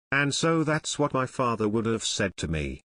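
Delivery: usual speaking voice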